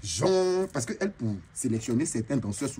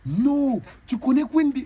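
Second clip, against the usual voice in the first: high, loud amazed question; amazed cry; 11 semitones higher, 5 dB louder, slower than usual. Retold or imitated. Brazilian fans hearing of [name], amazed high cry. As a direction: gasp out the question in disbelief.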